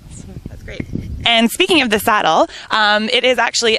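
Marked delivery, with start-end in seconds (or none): none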